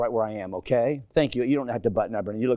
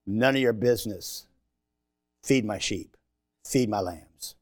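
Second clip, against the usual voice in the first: gentle Jesus voice; 6 semitones lower, 5 dB quieter than usual. Acted.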